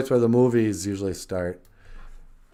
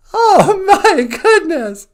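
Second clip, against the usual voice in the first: laughing exclamation; 12 dB louder, slower than usual. Real, not acted. Listener reacts with laughter.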